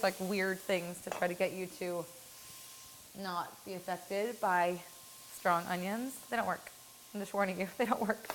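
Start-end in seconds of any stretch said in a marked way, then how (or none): none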